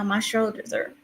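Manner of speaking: normal talking voice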